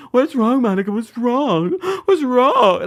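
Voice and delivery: high, hammed-up tearful concern; mock someone about to burst into tears; imitating near-tears. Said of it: "she sounds like she's about to cry".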